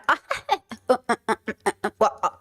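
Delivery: long staccato laugh; a string of short laugh bursts. Real, not acted. Streamer laughing while searching for the video, real laugh.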